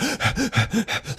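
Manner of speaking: rapid gasps of sudden fright, acted out; panicked gasping. Camper retelling waking terrified in the tent, panicked gasps. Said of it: "all of a sudden I'm like".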